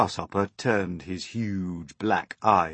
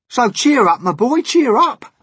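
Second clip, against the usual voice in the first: very high, loud centipede shout; brash centipede; 15 dB louder than usual. Acted. Centipede cheering up James, brash shout. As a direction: belt it out to rally him.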